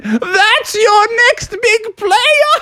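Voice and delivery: high, slow mocking skunk voice; a high, taunting skunk character; mocking Stinky. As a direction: sneer it out in a high squeaky voice.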